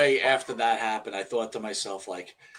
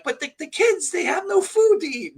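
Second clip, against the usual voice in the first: much higher, hammed-up wife voice; mock pleading wife; 14 semitones higher than usual. Wife pleading about the hungry kids, mock plea. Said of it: "she's like".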